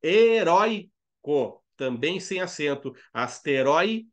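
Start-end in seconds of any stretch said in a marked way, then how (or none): 0.0-1.6 HERÓICO slow, higher and louder, ROI held; stressed penultimate syllable. Shown as a demonstration. Teacher giving an example, "Heróico" slow and loud on ROI. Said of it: "A sílaba forte é a penúltima". 3.1-4.1 ASTEROIDE in syllables, weight on ROI; diphthong example. Shown as a demonstration. Teacher listing examples, "Asteroide" split with strong ROI. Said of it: "O E começa alto e cai pro I".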